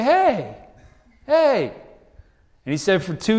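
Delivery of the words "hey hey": mock outrage. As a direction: squeal in mock offense. We protest God's verdict.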